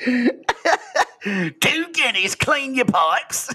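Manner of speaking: high, very slow Cockney street cry; Cockney chimney sweep. Pretend. Sweep offering his services for pay, high drawn-out Cockney. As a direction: stretch it out high, like a Cockney hawker. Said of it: "Cockney eighteen eighties chimney sweep".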